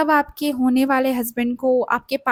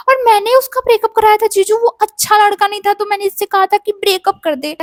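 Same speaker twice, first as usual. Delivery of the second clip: eager covering up; 7 semitones higher, 7 dB louder than usual. Retold or imitated. Covering for cousin on call.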